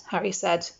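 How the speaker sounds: ordinary delivery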